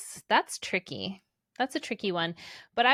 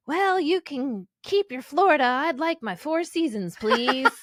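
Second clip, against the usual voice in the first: put-on slow, louder old grandpa drawl; 4 semitones higher, 4 dB louder, slower than usual. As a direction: play the folksy grandfather, unhurried. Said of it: "That was such a good grandpa voice".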